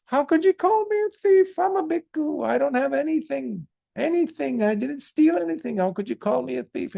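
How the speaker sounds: very high, loud, indignant squeal; a wronged monk pleading he stole nothing; high indignant monk denying the theft; protesting monk. Acted. Monk protests his innocence.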